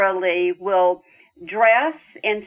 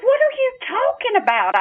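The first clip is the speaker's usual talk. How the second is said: much higher, startled what-do-you-mean; incredulous protest; faster than usual. Retold or imitated. Daughter protesting her father's claim, high incredulous pitch. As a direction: push back in disbelief.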